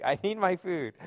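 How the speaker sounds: a lightly voiced baby begging for food; demanding baby nephew.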